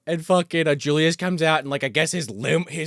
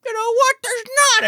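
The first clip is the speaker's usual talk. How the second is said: high, louder hammed-up reply; high mock Rita; 4 dB louder than usual. Rita teasing Max about nobody else, high and hammy. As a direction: tease him pitched high. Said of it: "she's like".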